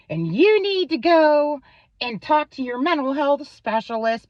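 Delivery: high, loud whiny mock of the commenter; high, loud mocking voice of the ex scolding her online; mocking his comment. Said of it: "and he's going".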